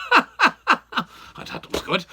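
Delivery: slow, chuckling through the words; amused at his own joke, laughing. Real, not acted.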